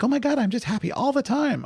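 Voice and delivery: high chirpy mock gushing; mocking cheery voice. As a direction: squeal it like a fake-happy friend. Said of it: "they're like".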